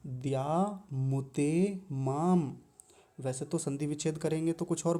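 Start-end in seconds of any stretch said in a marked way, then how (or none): 0.1-2.6 द्यामुतेमाम drawn out very slowly, each syllable clear; slow recitation. Shown as a demonstration. Teacher modelling the word, very slow and careful. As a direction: spell out द्यामुतेमाम at half speed. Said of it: "तो द्यामुतेमाम".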